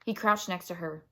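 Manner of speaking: ordinary delivery, nothing marked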